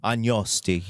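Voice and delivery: "Agnosti" as "an-yos-TEE", final c dropped; French-style pronunciation. Shown as a demonstration. Another go at how the French would say it, Frenchified. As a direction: say "Agnosti" with a French ny and open ending. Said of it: "the French would say".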